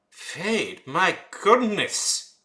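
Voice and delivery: high, slow, scandalized gasp; mock outrage. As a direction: clutch your pearls, drawn out.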